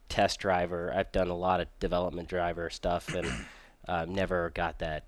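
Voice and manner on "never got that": ordinary talk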